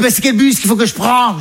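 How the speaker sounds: imitated mother. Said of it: "Elle dit". (voix haute de la mère imitée)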